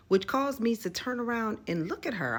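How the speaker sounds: normal talking voice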